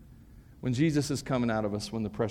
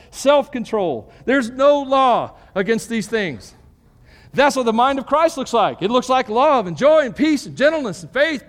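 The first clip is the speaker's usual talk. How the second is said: high, loud preaching voice driving each point home; full-force preaching; 10 semitones higher, 8 dB louder than usual. Acted. Pastor preaching the fruit of the Spirit, at full force. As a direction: preach it out big to the whole room.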